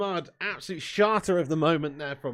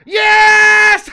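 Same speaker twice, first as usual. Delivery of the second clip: all-out triumphant roar of joy; ecstatic shout; 20 dB louder than usual. Real, not acted. Fan roaring as the rival's penalty is missed, ecstatic shout. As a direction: explode with a huge high, loud "Yes!".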